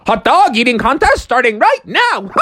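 high, excited contest announcer ending in a laugh; Mad Hatter. Acted. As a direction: announce it with manic glee, pitched high. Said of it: "the Mad Hatter was like".

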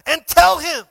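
loud urgent prophet's call; a prophet shouting urgent orders; Elijah shouting. Retold or imitated. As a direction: shout the warning to hurry. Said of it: "Elijah shouted".

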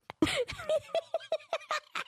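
soft high giggle under the breath; giggle, high and thin; high squeaky laugh. Real, not acted. Woman laughing at finding her car, squeaky giggle.

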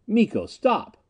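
high-pitched, urgent shout; shouted plea. A narrator's reading. Narrator shouts to Miko to stop the torture, urgent. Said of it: "I shouted".

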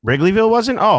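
can't believe what she's hearing; surprised. Real, not acted. Hearing Wrigleyville used to be unsafe, surprised.

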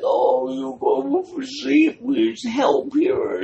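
put-on high, slow, sugary old lady voice; sweet old lady. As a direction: coax them off the ship, very high and slow.

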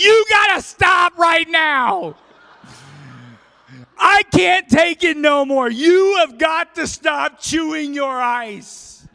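high, loud, slow yelling; furious shout. Retold or imitated. Pastor yelling at the ice-chewing pastor, furious and loud. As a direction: bellow it like you've snapped. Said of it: "I slammed it on there".

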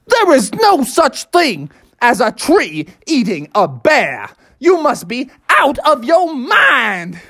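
slow, emphatic cop voice; incredulous police officer. Acted. Officer tells dad trees don't eat bears, incredulous. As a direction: spell it out with exaggerated authority.

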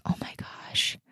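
breathy whispered gasp of relief; relieved whisper. Retold or imitated. Telling listeners about relief after the call, whispered. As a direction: let it out in a hushed whisper, like a weight lifting.

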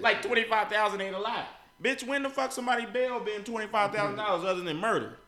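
indignant rant. Real, not acted. Angry rant about donations.

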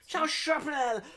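squeaky mock-cheerful hello; exaggerated high greeting. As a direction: overdo the hello, high and bright.

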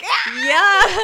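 loud, high imitation shriek; high-pitched yelp, acting out a panic; mock scream. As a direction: re-enact a startled scream at full volume. Mimics friend's scared reaction.

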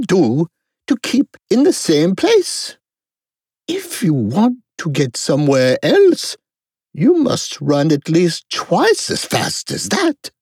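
high-pitched royal lecturing; imperious Queen. A narrator's reading. Queen explains her country.

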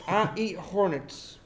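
slow deliberate voice. Acted. Agent character slow speech.

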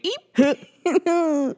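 small high-pitched squeak; drawn-out, squeaky little eep; imitated eep. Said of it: "I think it was more of".

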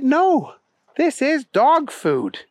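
put-on high, insistent little girl; high-pitched, drawn-out girl voice. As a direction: insist in a high child's voice, slowly.

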